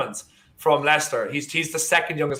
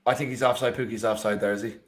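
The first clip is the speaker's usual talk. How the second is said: ordinary talk, nothing marked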